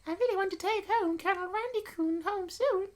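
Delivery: put-on very high squeaky girl voice; eager girl character. Reader plays girl wanting to take mascot home, very high. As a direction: squeal it in a cutesy high pitch.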